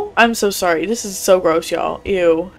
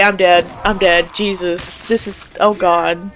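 usual speaking voice

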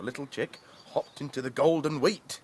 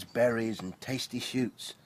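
ordinary delivery

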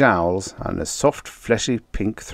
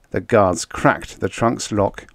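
ordinary delivery, nothing marked

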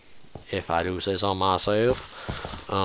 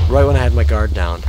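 frustrated outburst; 9 dB louder than usual. Real, not acted. Complaining after creeper attack.

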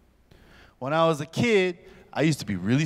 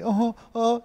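scared whimpers; 7 semitones higher than usual. Retold or imitated. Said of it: "and you're like".

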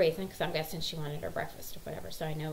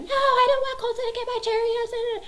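put-on loud, high child tantrum; piercing mock meltdown; Hannah freaking out; 8 dB louder than usual. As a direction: mimic a five-year-old shrieking in protest. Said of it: "Hannah starts freaking out".

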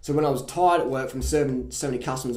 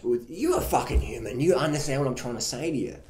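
ordinary talk, nothing marked

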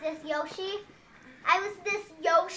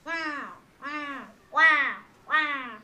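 mouth-shaped wow; 3 semitones lower, much slower than usual. Shown as a demonstration. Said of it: "go like this with your mouth".

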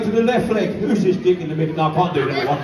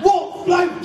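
waiter shouting; 9 semitones higher than usual. Retold or imitated. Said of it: "And they say".

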